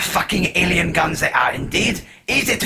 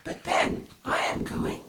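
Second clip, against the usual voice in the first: hushed, breathy alien confession; quiet confession; 8 semitones higher, 9 dB quieter than usual. Acted. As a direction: confess it under your breath.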